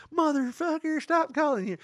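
very high, loud hammed-up cursing; mock yell. Fed-up man imagines yelling at telemarketers, high mock screech. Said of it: "be like".

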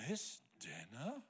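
slow, appalled questioning; hammed-up disbelief, swooping up at the end; mock shock. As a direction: draw it out as if scandalised. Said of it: "look at me like I slapped them in the face".

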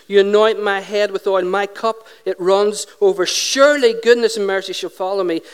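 loud, measured psalm declamation; scripture reading. Acted. Preacher reading Psalm twenty-three to congregation, declaimed. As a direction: proclaim each phrase firmly, with pauses between.

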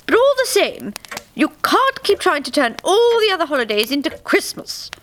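high, apologetic queen's voice; a gentle scolding sigh, pitched high; apologetic May Queen. A narrator's reading. May Queen scolding the spirit gently, high apologetic voice. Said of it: "said the May Queen apologetically".

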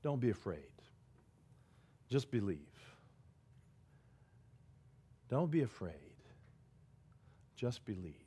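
hushed, gentle comfort to a broken man; quietly steady him, almost under the breath; soft reassurance. Acted. Jesus softly comforts the grieving father, hushed and gentle.

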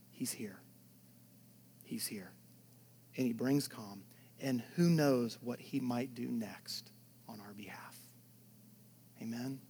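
soft, low, unvoiced reassurance; whispered assurance. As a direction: whisper it like a secret comfort.

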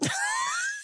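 sudden high shriek of a laugh; high burst of laughter. Real, not acted. After quoting the date, a shrieking laugh.

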